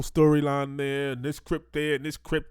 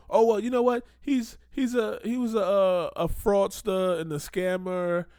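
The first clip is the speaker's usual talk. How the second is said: higher, put-on voice of a gullible backer; mock excusing; 6 semitones higher, slower than usual. Commentator mimics backers excusing a fraudster, higher mock-reasonable voice. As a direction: imitate someone brightly waving away a record. Mocks donors excusing a scammer.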